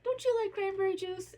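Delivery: high sing-song grandma offering snacks; imitating grandma. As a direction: play the doting Italian grandma pushing food. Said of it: "she'd be like".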